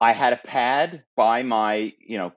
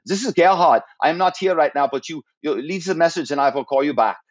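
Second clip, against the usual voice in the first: higher-pitched imitation German accent; 5 semitones higher than usual. German executive's voicemail greeting, in a put-on German accent. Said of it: "I would hear his accent".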